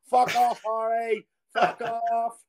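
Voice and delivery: put-on a drunk player swearing back; mock drunk yell. Drunk player telling the manager to back off, mock yell. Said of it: "Dowie was like".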